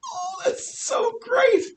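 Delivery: mock cheer. Teacher acts a baron's delight, hammed-up high cry.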